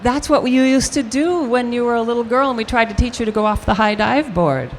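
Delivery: mother recalling with a hint of amusement. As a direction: lightly voice the mother remembering. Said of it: "She said".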